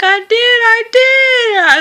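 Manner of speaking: high, slow pleading wail; mock distressed insisting; high whiny insisting. Insisting she soiled herself.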